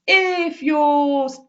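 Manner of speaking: "If your" stretched very slowly on a high, sliding pitch; repeated intonation pattern. Shown as a demonstration. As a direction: draw out "If your" high and singsong. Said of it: "voice always does this".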